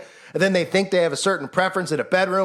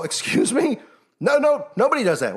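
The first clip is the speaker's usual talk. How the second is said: higher, outraged pushback; indignant retort; 6 semitones higher than usual. Pretend. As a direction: act out a scandalized refusal. Imagined indignant retort to Gates.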